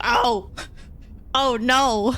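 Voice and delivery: comic sinking realisation; mock dismay. Acted dismay at hearing Lubbock.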